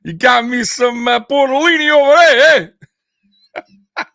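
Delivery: loud, high mock New York wiseguy; playful New York Italian impression; New York accent. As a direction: ham up a New York goodfella asking for pasta. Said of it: "is that his New York accent over there?".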